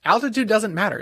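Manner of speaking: emphatic declaration. Retold or imitated. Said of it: "I'm like".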